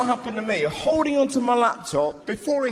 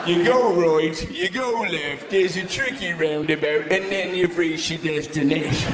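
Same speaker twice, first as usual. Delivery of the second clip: sat-nav voice; 6 semitones lower than usual. Retold or imitated. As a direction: read the directions like a car navigation voice. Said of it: "like a fleshy sat-nav".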